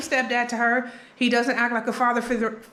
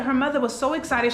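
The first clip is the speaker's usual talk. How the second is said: usual speaking voice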